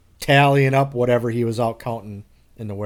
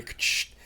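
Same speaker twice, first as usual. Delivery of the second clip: imitated CD tray click; 8 dB quieter than usual.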